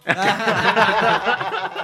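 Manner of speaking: loud burst of laughter. Real, not acted.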